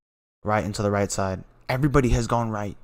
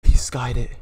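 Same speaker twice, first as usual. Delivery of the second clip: loud, raised-pitch disbelief; stunned exclamation; 4 semitones higher, 10 dB louder than usual. Real, not acted. Fan reacting to the decisive miss, stunned exclamation. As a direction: blurt it out loudly, stunned.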